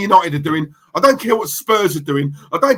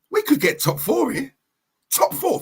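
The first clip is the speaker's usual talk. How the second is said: higher pitch with lighter volume; mock hushed excitement; 5 semitones higher, 5 dB quieter than usual. Manager floating a new target to the squad, dawning excitement. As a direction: play dawning hope, voice lifting softly. Acted dressing-room talk, hopes rising.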